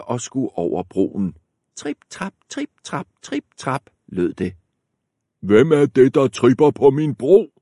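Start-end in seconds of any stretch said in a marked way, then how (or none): 1.8-3.9 small, high and soft steps; soft little trip trap of the smallest goat; light high tripping. Acted. Smallest goat crosses the bridge. 5.4-7.5 loud, high troll bellow; troll yelling at the goat on his bridge; troll shouting. Acted. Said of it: "råbte trolden".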